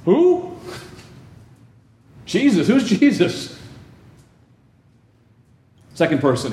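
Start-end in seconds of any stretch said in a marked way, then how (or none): 0.0-3.6 very high, mock-innocent denial; feigned surprise. Peter pretending not to know Jesus, mock-innocent and very high. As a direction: play dumb in a squeaky voice.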